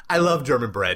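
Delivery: demonstrative burst of love; playful attempt at heartfelt enthusiasm; emphatic declaration. Shown as a demonstration. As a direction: declare your love with mock earnestness. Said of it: "I can try".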